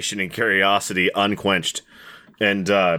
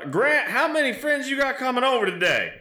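much higher voice calling from the back room; calling out as wife. Acted. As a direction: call out to the husband from another room. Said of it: "from the back calls out".

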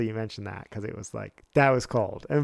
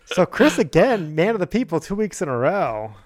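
hype announcer; 5 semitones higher, 4 dB louder than usual. Acted. Hyping up a cohost.

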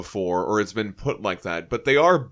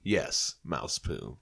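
deadpan; 8 semitones lower, 11 dB quieter, much slower than usual. Acted. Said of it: "deadpan low answer".

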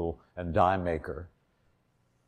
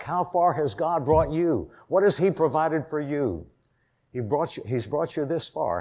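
louder and higher, driving sermon voice; full-force preaching; 4 semitones higher, 4 dB louder than usual. Acted. Preacher urging the congregation to trust God, fervent. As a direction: preach it out with rising fervour.

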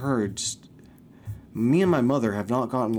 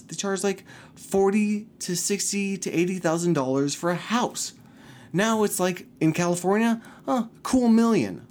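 rising outrage; 7 semitones higher than usual. Real, not acted. Ranting about house prices.

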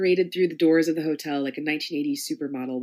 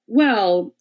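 retort; 4 semitones higher, 8 dB louder than usual. Acted. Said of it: "she retorted back".

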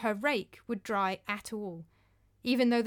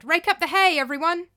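loud urgent call to everyone; shouting; 8 semitones higher, 9 dB louder than usual. Acted. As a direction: call the whole field to work. Calling out to all.

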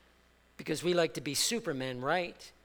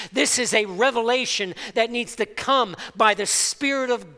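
loud, rising sermon climb, louder and higher throughout; full-force preaching; 6 semitones higher, 10 dB louder than usual. Acted. Pastor preaching identity to his church, full force. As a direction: drive it home to the congregation, building.

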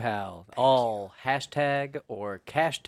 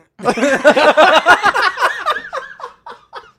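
loud high laugh; 15 dB louder than usual. Real, not acted. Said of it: "That was perfect".

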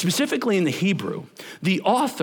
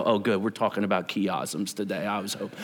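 sarcastic; 9 semitones lower, 5 dB quieter than usual. Pretend. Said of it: "nudge your neighbor and go".

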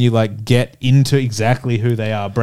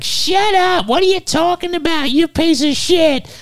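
much higher, scolding woman's voice; high-pitched mock wife yelling at her husband; shrill nagging woman. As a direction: berate him like an angry wife. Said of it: "a woman comes out ... is like".

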